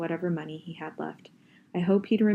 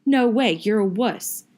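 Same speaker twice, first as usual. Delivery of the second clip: higher, louder, slow scorn; loud slow taunt; 4 semitones higher, 7 dB louder than usual. Acted. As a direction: jeer it out at him. Friend quarrel, insults.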